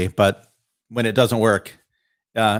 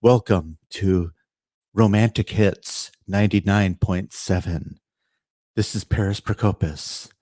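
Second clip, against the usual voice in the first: dreamy radio voice; 6 semitones lower than usual. Pretend. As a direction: purr it like a romance station host. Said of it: "my dreamy radio voice now?".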